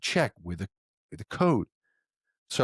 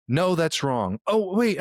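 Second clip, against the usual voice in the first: flustered mom; 7 semitones higher, 3 dB louder, slower than usual. Retold or imitated. Mom correcting herself.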